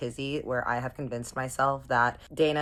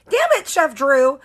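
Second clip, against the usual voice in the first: high, loud cry of frustration; exasperated mock yell; 12 semitones higher, 13 dB louder than usual. She hears about his long-distance fiancee, exasperated yell. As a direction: yell his name in hammed-up dismay.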